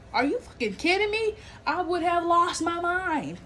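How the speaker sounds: high outraged cry, slowing down on lost my mind. Real, not acted. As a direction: blow up in disbelief. Outraged at the intruder's excuse.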